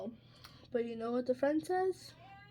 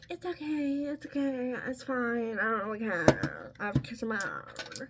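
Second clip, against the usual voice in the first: sweet voice. Retold or imitated. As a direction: play a scary man being sweetly forgiving. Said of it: "He was scary with a sweet voice".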